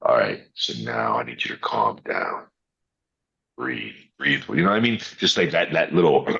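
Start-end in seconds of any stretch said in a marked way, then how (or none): 0.0-4.4 low, soft, calming dad voice; dad's dropped-octave voice. Retold or imitated. Dad talking his son down in the pool, low and calm. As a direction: drop deep and soothe. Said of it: "drop a couple of octaves".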